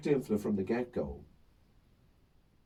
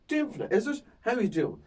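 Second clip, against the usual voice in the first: bright, higher mock-friendly needling of a heckler; mock-friendly stage banter; 9 semitones higher than usual. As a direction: fake sweetness to set her up. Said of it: "So I go".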